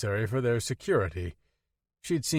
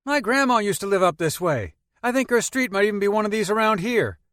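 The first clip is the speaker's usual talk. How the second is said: put-on high, lively teenage boy voice; young Peter talking about his grandma; 10 semitones higher, 7 dB louder than usual. A narrator's reading. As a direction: play the chatty kid in the back seat.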